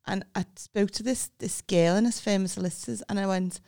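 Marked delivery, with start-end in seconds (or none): none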